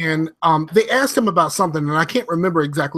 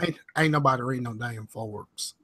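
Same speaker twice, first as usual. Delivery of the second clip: put-on Southern accent; 5 semitones lower, 9 dB quieter than usual. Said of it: "Why you gotta read the Southern accent?".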